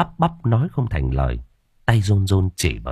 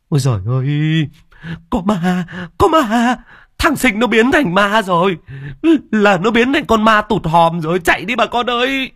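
high, louder shrieking cries of fear; screaming in terror; 11 semitones higher, 3 dB louder than usual. Acted. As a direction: shriek it as you run from a ghost. Women fleeing, screaming about ghost.

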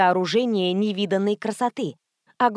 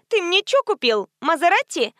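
high-pitched, insistent query; pitched-up, eager questioning; demanding phone question; 9 semitones higher, 3 dB louder than usual. A narrator's reading. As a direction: quiz a boyfriend excitedly over the phone. Brunette quizzes boyfriend by phone.

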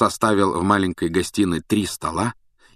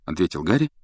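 ordinary delivery

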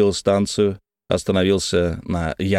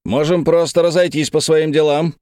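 raised, higher-pitched call; shouted offer; 7 semitones higher than usual. A narrator's reading. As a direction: call it out across a dark room. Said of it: "крикнул я".

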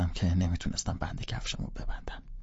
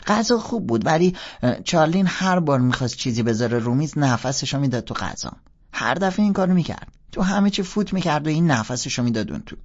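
put-on high, bright-eyed kid sister confessing; little girl voice; 7 semitones higher, 6 dB louder than usual. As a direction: play the young sister, pitched well up. (صدای دختر بچه، زیر و بلند)

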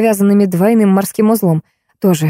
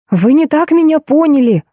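babbling; 7 semitones higher than usual. A narrator's reading. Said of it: "залепетала я".

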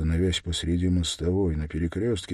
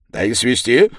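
loud, raised chiming-in; emboldened townsman; 4 semitones higher, 9 dB louder than usual. A narrator's reading. Townsman backs taking the stranger to the station, emboldened. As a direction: jump in with new nerve. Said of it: "подхватил ободрившийся мещанин".

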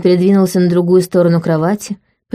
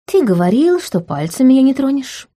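higher-pitched mock protest; feigned indignation; 6 semitones higher than usual. A narrator's reading. Heroine after a stolen kiss, reminding him with put-on indignation. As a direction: remind him, playing at being offended. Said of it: "напомнила с напускным возмущением".

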